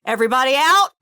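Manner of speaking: yelling out. Acted. Kat tells the group to clear the office, yelling out. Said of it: "she'll just yell out".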